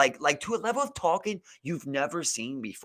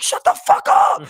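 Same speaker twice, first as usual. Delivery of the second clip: sudden furious yell, much higher and louder; shouting; 13 dB louder than usual. Retold or imitated. Student exploding at nagging teacher, furious yell. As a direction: blow up and scream it at the teacher. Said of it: "I'm just like".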